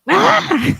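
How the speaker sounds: high, loud fake scream; mock scream. Streamer joking about Nancy Grace in bed, mock scream. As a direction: squeal it out as a joke. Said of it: "screaming like".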